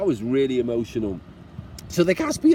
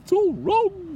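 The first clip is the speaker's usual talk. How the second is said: much higher, hammed-up sob of self-pity; mock tearful whine. Solo traveller jokingly laments single room rates, mock tearful. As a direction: pretend to well up over paying alone. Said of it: "That was a bit of a tear".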